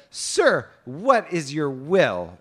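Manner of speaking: high, loud, slow formal address; maiden's reply. A narrator's reading. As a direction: hail him as a storybook maiden, drawn out. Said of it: "she rode out to him and said".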